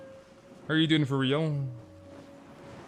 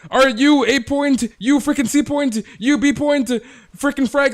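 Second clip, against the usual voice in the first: very high, loud, eager callouts; eager gamer guys; 11 semitones higher, 11 dB louder than usual. Retold or imitated. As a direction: teammates rushing to impress the girl. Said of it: "the guys are like".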